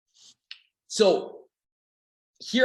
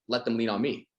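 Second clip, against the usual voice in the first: ordinary delivery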